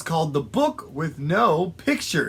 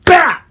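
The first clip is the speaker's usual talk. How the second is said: sudden loud, high "bap"; explosive imitated smack; impact noise; 12 semitones higher, 14 dB louder than usual. A narrator's reading. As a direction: punch it out like a ball hitting. Sudden ball hit in story.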